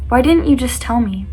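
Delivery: ordinary talk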